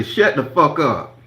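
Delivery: loud, slow shout; retold yell. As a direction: bark it at him, slow and loud. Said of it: "I say".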